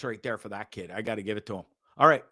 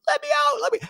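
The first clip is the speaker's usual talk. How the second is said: very high squawked cry for help; imitating a parrot's panicked cry, pitched way up; shrill parrot cry; faster than usual. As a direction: screech it like a parrot begging to be let out. Parrot mimicking cries for help.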